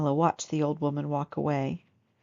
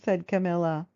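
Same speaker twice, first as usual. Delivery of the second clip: ordinary delivery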